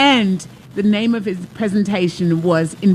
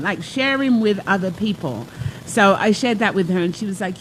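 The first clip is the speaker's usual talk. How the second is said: ordinary talk, nothing marked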